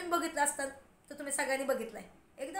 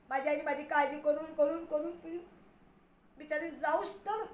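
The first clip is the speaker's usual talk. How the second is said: tearful, voice cracking and trailing off; fighting back tears while talking about mother; choked up, words repeating as she breaks; voice breaking; 4 semitones higher than usual. Real, not acted. Grieving over her late mother.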